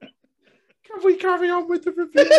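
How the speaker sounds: high, slow laughing protest; laughing plea. Real, not acted. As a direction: beg through laughter.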